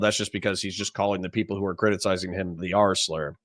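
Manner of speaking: ordinary talk, nothing marked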